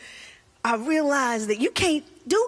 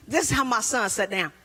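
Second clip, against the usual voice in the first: ordinary delivery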